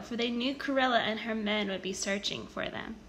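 plain reading voice

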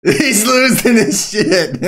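high, loud, laughing through it. Real, not acted. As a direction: crack up while saying it. Laughing at the enemy player.

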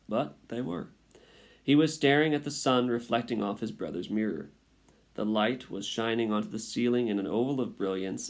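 plain reading voice

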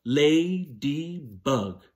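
"Ladybug" stretched into three slow beats, lady-bug clapped; very slow syllables. Shown as a demonstration. Teacher leading the class to clap "Ladybug", very slow. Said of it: "clap the syllables in ladybug".

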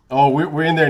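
ordinary talk

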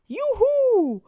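very high, loud whoop; joyful shout. A narrator's reading. All the children shout for joy. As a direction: whoop with delight. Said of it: "schrien alle".

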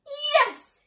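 very high, loud whoop of yes; triumphant shout. A narrator's reading. Mister Fox jumping into the storehouse, shouting. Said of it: "he shouted, jumping in the room above".

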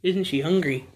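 ordinary delivery

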